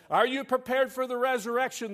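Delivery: high, loud, exasperated plea; frustrated preacher. Acted. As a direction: press it out in frustration. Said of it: "the frustrated preacher asked".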